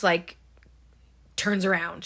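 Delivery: ordinary talk, nothing marked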